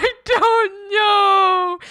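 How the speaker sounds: high, very slow and loud, sobbing with laughter; real laugh-sob, stretched out; laugh-crying harder. Real, not acted. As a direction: let the laugh and cry take over.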